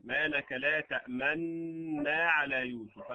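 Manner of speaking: slow, تأمنا with one merged nun and rounded lips; recited with ishmam. Shown as a demonstration. Teacher closing the ishmam demonstration, slow recitation. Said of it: "هذا وجه الإشمام".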